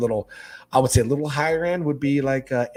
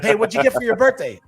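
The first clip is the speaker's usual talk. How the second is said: mock friend; 11 semitones higher, 6 dB louder than usual. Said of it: "acting out a friend's question".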